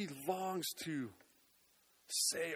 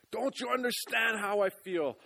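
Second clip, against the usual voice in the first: loud, high anguished cry; yelled out; 9 semitones higher, 9 dB louder than usual. Pretend. Doubting believer yelling at Jesus, loud anguished cry. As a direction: shout it out in pain. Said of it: "you just want to yell out".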